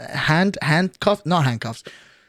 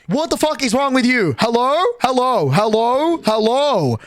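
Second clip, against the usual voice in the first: excited; 6 semitones higher than usual. Real, not acted. Stunned by twenty-five gifted subs.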